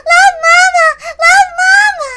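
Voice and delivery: put-on high, loud, squealing child, laughing and crying; overjoyed little girl. Little Maria hears she gets dessert, high ecstatic squeals. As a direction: shriek with joy like a tiny girl.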